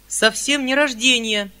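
put-on a girl bursting out against the lie; girl's cry. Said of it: "крикнула Сашенька". (звонкий выкрик девочки)

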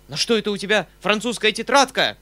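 loud, high outburst of rage; furious shout. A narrator's reading. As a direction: pounce on the offence angrily. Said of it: "с яростью закричал он".

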